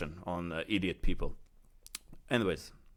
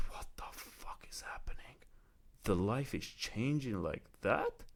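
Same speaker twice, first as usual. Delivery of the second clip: awed whisper; 2 semitones higher, 8 dB quieter than usual. Retold or imitated. Stunned on hearing the car floats.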